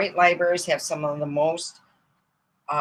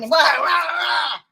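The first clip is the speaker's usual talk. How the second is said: mock whacking noises; 12 semitones higher, 6 dB louder than usual. Imitating rolling pin blows.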